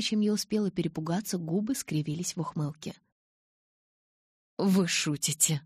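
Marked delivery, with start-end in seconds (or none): none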